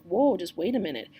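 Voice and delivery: ordinary talk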